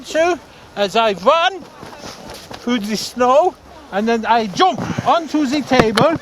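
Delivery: lightly put-on Norwegian accent; a hint of a Norwegian accent; mock Norwegian accent. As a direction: play a bumbling Norwegian skier. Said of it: "like a Norwegian Frank Spencer".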